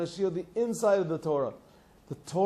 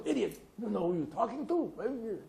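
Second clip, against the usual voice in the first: mock angry retort; 5 semitones higher, 4 dB quieter than usual. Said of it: "They get all angry".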